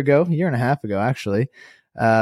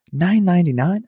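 higher-pitched, rising disbelief; incredulous; 7 semitones higher than usual. Retold or imitated. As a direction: echo the price as a retold shocked question. Retelling his disbelief at the price.

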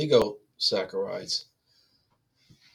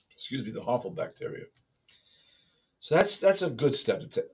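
talking through hiccups; hiccuping mid-sentence; 4 semitones higher, 4 dB louder than usual. Real, not acted. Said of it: "I got the hiccups".

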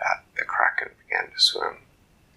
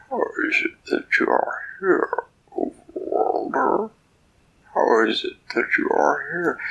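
an eerie giant, suddenly curious; kraken voice, puzzled; 8 semitones higher than usual. Acted. Kraken wonders how Dak is there, hollow puzzled monster voice. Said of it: "in that deep underwater voice".

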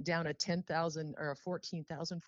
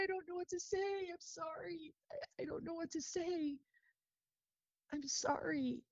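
imitating crying woman; 10 semitones higher than usual. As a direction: echo the sobbing woman's choked apologies. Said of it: "she was crying so hard, she sounded like she was choking".

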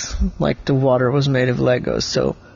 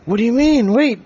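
higher-pitched, puzzled objection; startled protest; 7 semitones higher than usual. Acted. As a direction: call out in confusion, voiced for a story. Acted startled protest in story.

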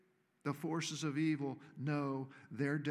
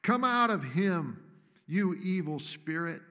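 loud commanding rebuke; commanding call; 3 semitones higher, 7 dB louder than usual. A narrator's reading. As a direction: call it out with authority. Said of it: "Jesus raises his hand and calls out".

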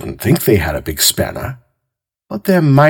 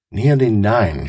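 ordinary delivery, nothing marked

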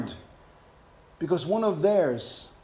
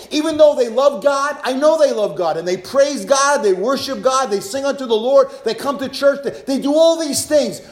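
raised, driving pulpit cadence; impassioned preaching; 5 semitones higher, 5 dB louder than usual. Real, not acted. Preacher listing how churchgoers love and praise God, impassioned preaching. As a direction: pile up each phrase with mounting force.

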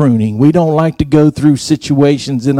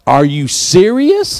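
higher and slow, lightly imitating disbelief; slow shocked question; 7 semitones higher, slower than usual. The new administrator to the speaker, mock shocked disbelief. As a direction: stretch it out like you can't believe it.

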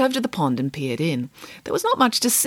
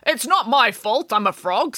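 frog; 4 semitones higher than usual. Acted. Frog complains.

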